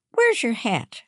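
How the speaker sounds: high, insistent mother's question; demanding. A narrator's reading. Mum to daughter who lost her hat, demanding. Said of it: "her mum demanded".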